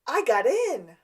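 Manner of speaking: loud, high cry of joy; excited outburst. Retold or imitated. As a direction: burst out like you just got accepted. Gus on the floor finding his file.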